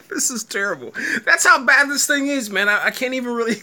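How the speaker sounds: words broken by laughter; laughing. Real, not acted. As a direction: talk through giggles. Laughing at the show.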